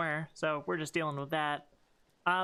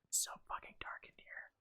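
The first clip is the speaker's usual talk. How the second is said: whispering; 13 dB quieter than usual. Real, not acted. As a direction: whisper it, scared of the dark. Creeping through dark room.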